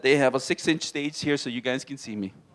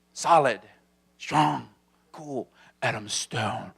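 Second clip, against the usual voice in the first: trailer voice; 7 semitones lower, 4 dB quieter, slower than usual. Pretend. Movie-trailer voice.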